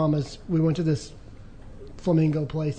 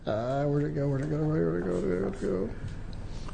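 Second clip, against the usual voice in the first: fading into a soft mutter, lower; muttering to himself; 3 semitones lower, 9 dB quieter than usual. Real, not acted. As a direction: mumble it while searching, fading out. Searching notes, trailing off.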